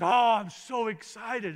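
slow, loud, high gush of delight; a touch of a starry-eyed player gushing. Retold or imitated. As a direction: gush like a thrilled young player. Player gushing about coaching change.

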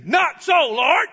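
bold, slow, high shout; really bold. Acted. Peter boldly refusing the Lord, bold loud shout. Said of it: "really bold and say".

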